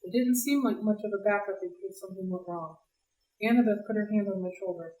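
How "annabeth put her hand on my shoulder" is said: plain reading voice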